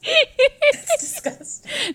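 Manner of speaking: slow, high, disgusted drawl; nauseated horror. Real, not acted. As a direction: stretch it out in laughing revulsion. Recoiling from lewd joke.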